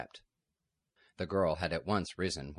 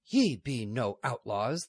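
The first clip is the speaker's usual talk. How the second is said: slow, louder scornful denial; defiant abbess; 5 semitones higher, 5 dB louder, slower than usual. Acted. Lady Superior calling their bluff, slow and defiant. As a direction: deny their claim with slow contempt. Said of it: "the Lady Superior said".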